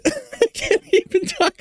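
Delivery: high, breaking up with real laughter; laughing helplessly. Real, not acted. Host to viewers, cracking up over the clip: real helpless laughter. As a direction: squeeze it out through laughter. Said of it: "I'm just laughing so hard".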